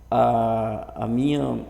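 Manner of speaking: ordinary delivery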